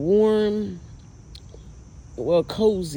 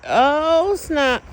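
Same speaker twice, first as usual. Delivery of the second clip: high and louder sudden exclaim; surprised; 11 semitones higher, 8 dB louder than usual. Real, not acted. Filming outside, cut off by a sudden surprise. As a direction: blurt it out in shock. Startled mid-sentence.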